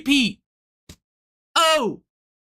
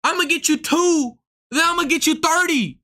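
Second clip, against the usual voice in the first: retold dare to fight at the club; fired-up challenge; 7 semitones higher than usual. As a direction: square up and call him out. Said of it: "I'm like".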